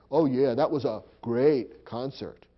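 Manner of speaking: slow, mock-enthusiastic sarcasm; sarcastic. Shown as a demonstration. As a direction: draw it out as if doubting every word. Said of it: "it's a way of being sarcastic".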